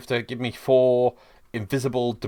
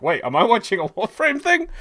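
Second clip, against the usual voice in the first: surprised; 12 semitones higher, 5 dB louder than usual. Retold or imitated. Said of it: "I'm like".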